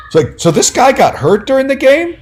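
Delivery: high rising mock-indignant question; retold heated challenge. Aquatics reporter questioning the story, in mock heat. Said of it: "And he was all heated".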